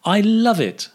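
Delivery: "love" pitched high, falling away on "it"; emphatic loving intonation. Shown as a demonstration. Teacher asking learners to notice intonation, high "love" in "I love it". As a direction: lift "love" right up, then drop. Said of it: "Notice the intonation".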